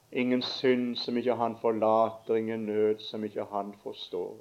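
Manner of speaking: prayer. Acted. Closing prayer.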